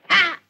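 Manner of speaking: loud sudden yelp; startled cry. Acted. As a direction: blurt it out in shock.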